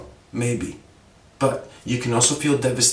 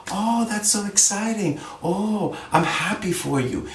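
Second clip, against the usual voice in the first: mock gushing; 8 semitones higher than usual. Shown as a demonstration. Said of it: "Instead of saying".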